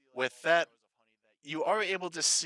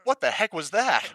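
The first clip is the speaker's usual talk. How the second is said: louder, higher startled outburst; startled bystander; 6 semitones higher, 8 dB louder than usual. Acted. Game master as someone outside hearing the bear roar, startled. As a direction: blurt it out in alarm. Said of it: "you hear one of them go".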